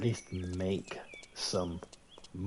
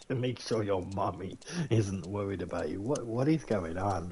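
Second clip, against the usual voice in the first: usual speaking voice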